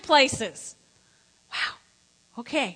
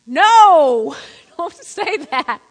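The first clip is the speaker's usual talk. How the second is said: loud, drawn-out preacher's no; emphatic refusal; 12 dB louder, much slower than usual. Real, not acted. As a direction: belt it out to the congregation. Preacher rejects the imagined morning insult.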